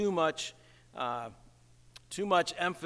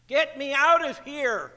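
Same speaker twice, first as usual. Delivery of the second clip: higher, whiny cry to be rescued; mock desperate plea; 8 semitones higher, 4 dB louder than usual. Preacher mocking a selfish prayer, mock desperate plea. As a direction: beg like a trapped man, hammed-up. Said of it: "the book of Scott".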